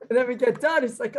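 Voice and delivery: usual speaking voice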